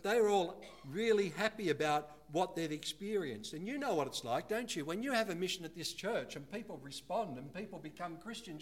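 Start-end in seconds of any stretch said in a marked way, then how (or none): none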